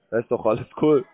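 ordinary talk

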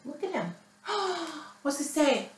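plain reading voice

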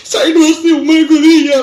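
high-pitched, hammed-up tearful wail; mock crying. Bereaved nephew announces uncle's death, mock crying. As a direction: wail it through put-on sobs. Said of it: "રડ નહીં, રડ નહીં".

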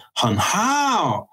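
"很好" pitched high and slowed, falling hard like English emphasis; emphatic 很好. Shown as a demonstration. Teacher showing the wrong emotional tone, high and slow. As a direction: lean on "很好" with a heavy fall. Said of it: "But if you say".

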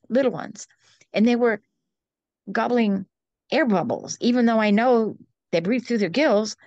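usual speaking voice

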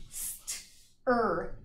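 stretched "st-er" sound. Shown as a demonstration. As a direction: say "st-er" slowly, sound by sound. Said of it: "The next sound".

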